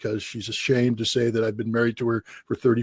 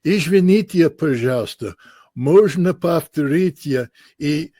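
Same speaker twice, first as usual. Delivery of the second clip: put-on careful, slow textbook Russian with an American accent; halting college Russian; 5 semitones higher, 4 dB louder, slower than usual. An American Marine asking a Soviet officer to repeat, in slow college Russian. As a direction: pick through each Russian word like a nervous student. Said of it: "in my best two-year college Russian".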